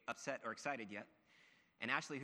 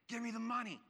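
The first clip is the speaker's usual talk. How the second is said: high, raised-voice mugger imitation; robber's demand; 10 semitones higher, 4 dB louder than usual. Young robber to his victims in poor English, high mock demand. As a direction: act out a mugger demanding cash, pitched up high. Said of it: "the one guy, he was just saying".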